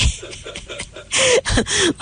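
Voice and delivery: airy high giggle; breathy giggle. Real, not acted. Laughs after being cut off.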